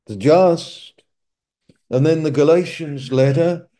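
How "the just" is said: "the just" lifted high and loud, twice; preacher leaning on "the just"; stress on the just. Shown as a demonstration. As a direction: hammer "The just" as the key words. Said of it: "the emphasis is on".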